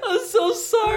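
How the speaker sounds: high, giggling "I'm so sorry"; laughing apology. Real, not acted. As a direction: apologize through laughter, not meaning it. Laughing apology after teasing.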